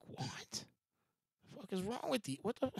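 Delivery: slow, softened mock outrage; hushed, imagined disbelief; retold disbelief. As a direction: drag out an incredulous question under the breath. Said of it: "I'd be like".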